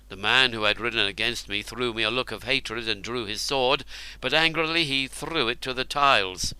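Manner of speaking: ordinary delivery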